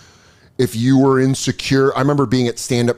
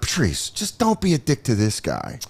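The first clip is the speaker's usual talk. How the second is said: hushed plea; 4 semitones lower, 6 dB quieter than usual. Retold or imitated. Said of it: "you're like".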